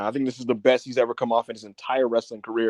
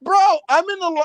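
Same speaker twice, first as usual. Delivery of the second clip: real excitement; 4 dB louder than usual. Real, not acted. Guest surprise, host still reeling.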